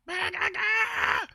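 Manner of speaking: sudden very high screeching shriek; demonic pterodactyl screech. Shown as a demonstration. Streamer shows chat his rage noise, an evil screech. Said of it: "I make the evil pterodactyl noise".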